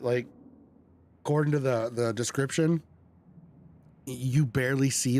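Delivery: usual speaking voice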